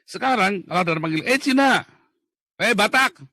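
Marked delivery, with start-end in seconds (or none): 1.3-3.2 high, loud friendly hail; playful call-out. Retold or imitated. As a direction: shout it out to tease a friend. (panggilan bercanda keras dan tinggi)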